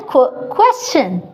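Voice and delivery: "Qu-, question" with the qu sound said alone first; sound split off. Shown as a demonstration. Teacher modelling "question" for the class. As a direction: break off "Qu-" then say "question". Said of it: "let's say it again".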